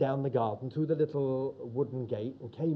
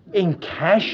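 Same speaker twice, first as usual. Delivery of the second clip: astonished; 4 semitones higher, 8 dB louder than usual. Retold or imitated. Neighbour stunned by cash offer.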